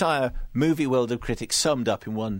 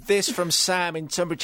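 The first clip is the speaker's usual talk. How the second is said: ordinary delivery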